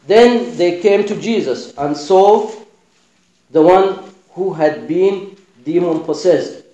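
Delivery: usual speaking voice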